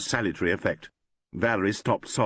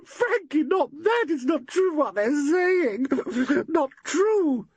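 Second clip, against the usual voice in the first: high tearful voice, slow and crying; a distraught young woman weeping; sobbing; slower than usual. A narrator's reading. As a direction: plead through sobs that it isn't true. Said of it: "she sobbed".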